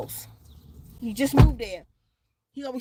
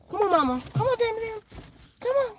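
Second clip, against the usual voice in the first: high coaxing call; 7 semitones higher, 3 dB quieter, faster than usual. Real, not acted.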